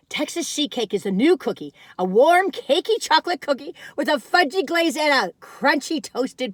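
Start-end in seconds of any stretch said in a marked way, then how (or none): none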